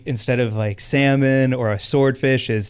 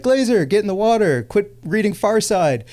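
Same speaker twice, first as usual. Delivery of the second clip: friends calling out; 6 semitones higher than usual. Retold or imitated. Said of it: "they were like".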